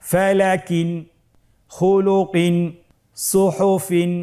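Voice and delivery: a teacher leading the class, word by word; fluent reading without spelling. Shown as a demonstration. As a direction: read it whole for the class to repeat. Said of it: "বানান ছাড়া দ্রুত করে পড়ব".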